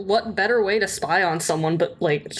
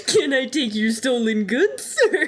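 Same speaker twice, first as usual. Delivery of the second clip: laughing fake-polite clerk; hammed-up courtesy, sir shooting up high; mock passive-aggressive; 4 semitones higher than usual. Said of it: "be real passive-aggressive and go".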